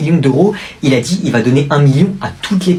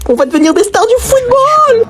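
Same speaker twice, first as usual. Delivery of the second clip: excited shout. Acted. Friend crowing that they will become football stars. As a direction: crow it out in triumph.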